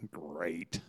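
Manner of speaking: low, flat sarcastic mutter; deadpan dismay under the breath; sarcastic great. Retold or imitated. Lady dreading her perfect partner, sarcastic and low. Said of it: "and I thought".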